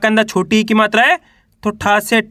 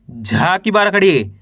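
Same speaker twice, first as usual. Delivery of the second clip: usual speaking voice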